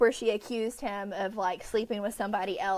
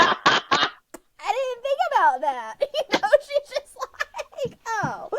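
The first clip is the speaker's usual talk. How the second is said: high, louder, giggling through the words; laughing through quote; 11 semitones higher, 6 dB louder, slower than usual. Real, not acted. Retelling Violet's realisation to cohost, laughing. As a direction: laugh while you say it.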